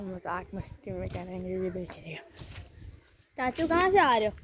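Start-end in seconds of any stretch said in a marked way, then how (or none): 3.4-4.3 loud, high call to someone down the road. Real, not acted. Vlogger calling out to his approaching uncle, loud and high. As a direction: shout the question across to him.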